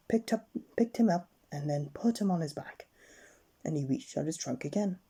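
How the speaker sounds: ordinary delivery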